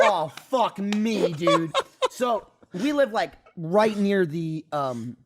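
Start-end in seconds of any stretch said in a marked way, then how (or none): 0.0-1.7 very slow, laughing-edged mock dread; drawn-out dismay. Storyteller realizing the neighbor is crazy, drawn-out dismay. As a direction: stretch each word in sinking realization.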